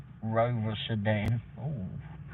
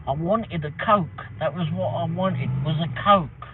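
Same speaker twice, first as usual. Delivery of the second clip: louder, higher, spelling it out; exasperated order; 6 semitones higher, 6 dB louder than usual. Retold or imitated. Retelling a drive-through order, loud and exasperated. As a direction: hammer out the order so they finally get it.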